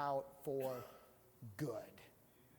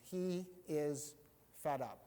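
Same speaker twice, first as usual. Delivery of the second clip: ordinary delivery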